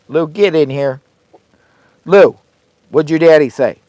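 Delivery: loud, high call to the dog. Real, not acted. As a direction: shout across the yard for Lou. Calling the dog inside.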